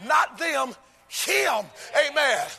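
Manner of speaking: loud, high, chanted climax of the sermon; full-force preaching. Acted. Preacher challenging the church, loud and half-sung. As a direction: build it and hammer each question home.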